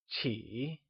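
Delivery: "Qi" softly dipping low, then climbing; falling-rising tone. Shown as a demonstration. Teacher asking learners to try again, "Qi" in a falling-rising tone. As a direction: dip the pitch on "Qi", then lift it. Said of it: "using the falling, rising tone".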